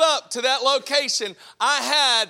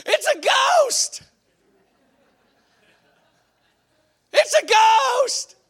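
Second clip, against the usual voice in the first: high, loud, slow panicked shout of a ghost; terrified cry; 13 semitones higher, 6 dB louder, much slower than usual. Retold or imitated. Disciples on the sea, shrieking in fear. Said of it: "and they're like".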